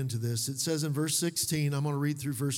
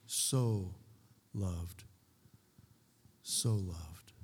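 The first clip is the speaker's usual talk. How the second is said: low, hushed "so", set apart by pauses; stress on SO; 8 semitones lower, 5 dB quieter, much slower than usual. Shown as a demonstration. Preacher dwelling on God's love, "so" low and lingering. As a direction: let "so" sink in, softly and slowly. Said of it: "There's a lot behind that little word".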